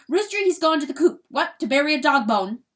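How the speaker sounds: high, loud and hurried; blustering weasel. A narrator's reading. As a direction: push the explanation out fast and loud. Weasel keeps blustering about the rooster.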